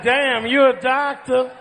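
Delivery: loud mock-pleased groan of a patient; over-the-top mock delight at the doctor; hammed-up patient. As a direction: play it up as the man who likes it. Pretend patient enjoying exam.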